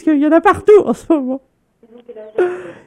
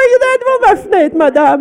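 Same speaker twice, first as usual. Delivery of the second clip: panicked; 5 semitones higher than usual. Pretend. Fake panic about Santa outside.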